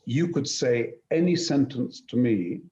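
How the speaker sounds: ordinary talk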